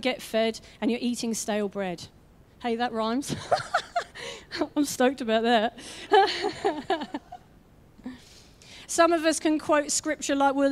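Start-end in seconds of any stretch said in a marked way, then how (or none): 3.4-4.7 a real high giggle; laugh, pleased with yourself; high laugh over a rhyme just made; high delighted laugh. Real, not acted. 5.8-7.4 pleased laugh. Real, not acted. Laughs at own rhyme.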